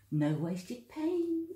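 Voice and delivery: high, slow sing-song. Real, not acted. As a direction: stretch it out, high and pleased. Pleased at clean pour.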